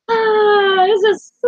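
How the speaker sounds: high, crying cry-laugh; laughing through real tears. Real, not acted. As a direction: break down mid-sentence.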